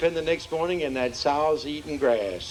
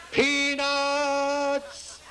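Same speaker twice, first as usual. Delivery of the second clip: vendor yelling; 9 semitones higher than usual. Retold or imitated. Circus peanut vendor calling out to the crowd, yelling. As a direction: bellow it like a peanut seller. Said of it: "through the tent yelling".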